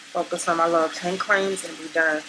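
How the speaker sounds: ordinary talk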